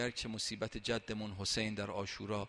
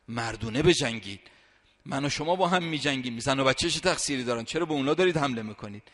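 loud, anguished call to the attackers; crying out; 3 semitones higher, 8 dB louder than usual. Retold or imitated. Imam Hussein calling out to the enemy: loud anguished cry. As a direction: rise up and shout the challenge with the last strength. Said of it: "فریاد زد".

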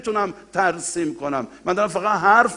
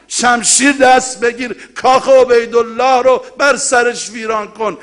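high and loud, scolding the crowd; furious rebuke; 6 semitones higher, 8 dB louder than usual. Retold or imitated. Zaynab scolding the weeping people of Kufa, high and loud. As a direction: hurl the words down at them like a rebuke. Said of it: "میگه".

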